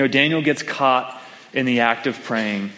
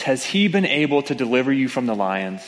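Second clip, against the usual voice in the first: ordinary delivery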